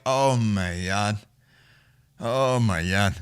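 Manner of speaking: slow, drawn-out mock dread; retold dread. Reliving a bad trip on the roof, slow retold dread. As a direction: stretch each word out slowly, stunned.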